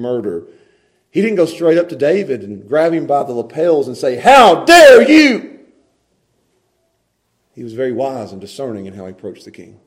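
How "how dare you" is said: loud, very slow "How dare you?"; mock outrage. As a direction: draw it out like a furious prophet. Nathan imagined confronting David.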